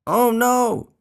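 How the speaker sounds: high, loud cry of dismay; dramatic high-pitched alarm; alarmed gasp. A narrator's reading. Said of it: "he gasped".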